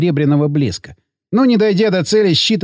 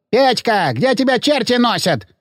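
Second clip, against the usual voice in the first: mild higher-pitched yell through a door; lightly acted scolding woman calling out, higher; woman's yell; 5 semitones higher than usual. A narrator's reading. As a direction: call the boy crossly, without real volume. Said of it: "Раздался за дверью бабий крик".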